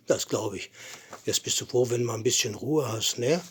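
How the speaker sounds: ordinary delivery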